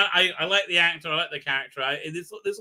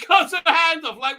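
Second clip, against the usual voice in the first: normal talking voice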